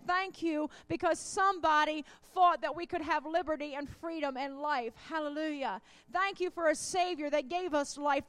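loud, fervent preaching, calling for amens. Acted. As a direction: rouse the congregation with full-voiced praise. Preacher fires up the congregation.

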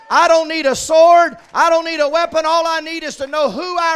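defiant shouted preaching; loud declaration. Acted. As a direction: proclaim it like a battle cry. Preacher voicing David, declaring.

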